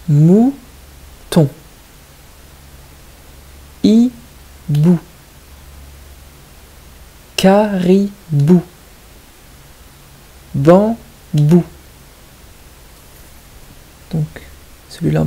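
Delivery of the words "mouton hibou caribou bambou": "Mouton", "Hibou", "Caribou", "Bambou", each broken into long separate syllables. Shown as a demonstration. Teacher setting an odd-one-out game, words split syllable by syllable. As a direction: dictate each word in slow, distinct beats, pausing between syllables. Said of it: "il faut bien écouter la deuxième syllabe".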